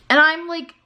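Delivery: exasperated rising voice; irritated. Real, not acted. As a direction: let the irritation push the pitch up. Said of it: "so irritated".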